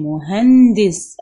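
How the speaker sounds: مهندس slow and loud, stopping hard on the silent ن; مهندس slow, loud, with a held silent ن; stressed sukun demo. Shown as a demonstration. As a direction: press the ن of مهندس with no vowel. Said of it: "أنا وقفت وسكنت الإيه؟".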